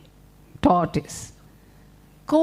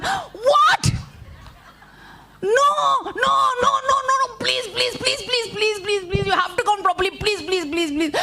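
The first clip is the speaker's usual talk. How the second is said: frantic pleading; 8 semitones higher than usual. Acted.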